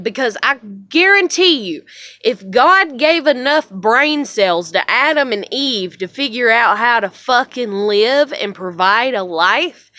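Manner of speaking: loud, emphatic, indignant tirade; angry rant. Real, not acted. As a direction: drive each point home, fired up and angry. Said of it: "I'm so mad".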